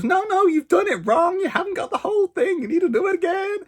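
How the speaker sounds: very high, bossy American correcting someone; hammed-up scolding American lady; wobbly put-on American accent, squeaky and insistent; mock American accent. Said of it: "I don't know what this accent is".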